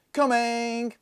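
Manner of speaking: put-on high, loud shout of a crowd of kids. A narrator's reading. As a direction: yell it out for the whole house. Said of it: "Everybody shouted".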